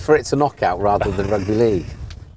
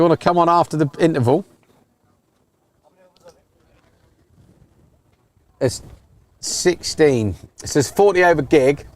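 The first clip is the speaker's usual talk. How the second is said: raised voice, calling across to a teammate; 8 semitones higher, 7 dB louder than usual. Real, not acted. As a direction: call out loud and high to someone far away.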